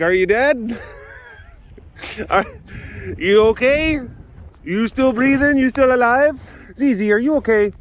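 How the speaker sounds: high-pitched questions to the animals; animal check-in voice. Acted. Walking round asking every animal if it is alive, high-pitched pet talk.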